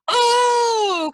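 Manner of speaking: sudden high loud "Oh!"; cried out. A narrator's reading. Green Knight crying out in shock at Arthur's answer. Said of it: "cried the Green Knight".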